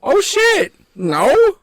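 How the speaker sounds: high, loud shocked gasp; a startled real yelp of disbelief. Real, not acted. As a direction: caught off guard, blurt it out high. Shocked reaction to question.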